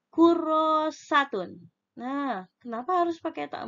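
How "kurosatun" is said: kurosatun said slowly and higher; slow dictation. Shown as a demonstration. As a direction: spell out kurosatun syllable by syllable.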